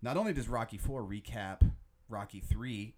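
usual speaking voice

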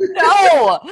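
loud, high, laughing; a shouted no through laughter. Real, not acted. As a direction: blurt it out mid-laugh. Laughing denial.

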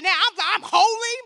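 loud righteous refusal; righteous indignation. Retold or imitated. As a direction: stand tall and refuse him. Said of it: "standing in righteous indignation".

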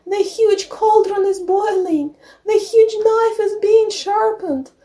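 high little-goat voice calling for help; very high wailing plea on one held note; bleating kid. A narrator's reading. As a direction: plead like a frightened baby goat. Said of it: "started bleating".

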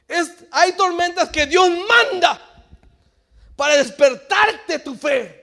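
loud, high preaching cry; fiery preaching. Acted. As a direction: preach it out with full force. Preaching at full force.

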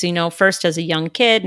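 usual speaking voice

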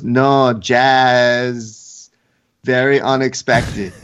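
drawn-out mock foreign accent; Valentina's accent. Host voicing a fighter insisting on her word, mock accent. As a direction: imitate her accent, stretching JUST into "jazz". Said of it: "at Valentina's accent".

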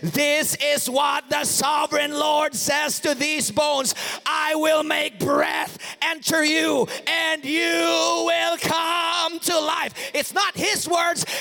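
loud, slow, proclaiming voice; full-force preaching. Acted. Preacher declaring God's word to the congregation, loud and slow.

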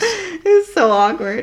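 laughing it out, high and slow. Real, not acted. Telling a friend about being cut from the wedding, laughing. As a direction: laugh through the words, embarrassed. Laughing through awkward story.

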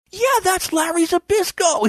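very high, giddy re-enactment; excited, pitch way up; thrilled reply. Retold or imitated. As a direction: imitate your own starstruck excitement. Own thrilled reply, acted.

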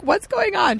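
high-pitched mock confusion; puzzled boyfriend. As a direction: imitate someone baffled on a video call. Imitates confused boyfriend on Skype.

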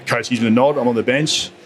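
ordinary talk, nothing marked

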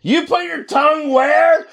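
slow, high shout, laughing; mock yell. Yelling man overhearing a library chat, laughing. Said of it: "You're just yelling".

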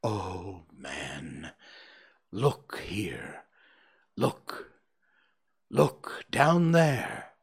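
hushed, slow urgent whisper; urgent ghostly whisper. Acted. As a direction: whisper it, dragging the last look. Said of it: "exclaimed the ghost".

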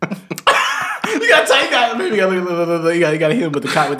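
shouting through laughter. Real, not acted. Laughing while explaining the bit.

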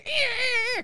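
very high squealed "eh"; high-pitched mock sound effect; imitated straining noise. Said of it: "You hear, like".

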